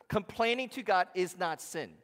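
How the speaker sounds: booming declaration; proclaiming loudly. Real, not acted. Preacher booming to the congregation, loud. Pastor proclaims key truth.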